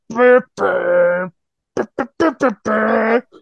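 hummed entrance theme; droning mock theme tune; knockoff entrance music. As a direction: mimic a cheap copycat entrance riff. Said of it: "this, like, weird knockoff music".

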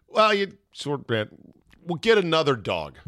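high-pitched, stumbling excuse; mock placating. Dad consoling daughter over lost dog, mock placating. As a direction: pretend to soothe with a hasty offer.

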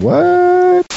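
very high, slow squeak; squeaky high voice. Pretend. Squeaky joke question in song.

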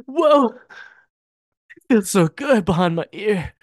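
slow, high, dreamy swoon; mock swooning. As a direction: swoon over the ear touch. Mocking swooning Lana.

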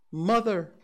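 high, loud cry to her mother. A narrator's reading. Girl running to her mother in the crowd, loud cry. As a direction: call out for your mother.

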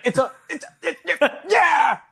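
high squeaky stammer, breaking into a laugh; hammed-up stuttering little chicken. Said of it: "mumbling and stuttering".

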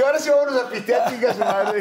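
cheerful laughing call to go drink. Retold or imitated. Boxer laughing as he retells quitting sobriety, high laughing voice. Retelling relief after the loss.

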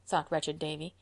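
plain reading voice

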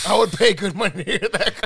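real amused laughter through the words; laughing. Real, not acted. Laughing at his emergency call.

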